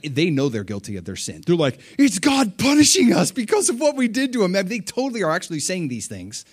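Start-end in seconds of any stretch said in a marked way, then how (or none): 2.0-4.6 high, frantic wail of guilt; scared brothers. Retold or imitated. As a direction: cry out in guilty fear, much higher. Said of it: "they're so scared".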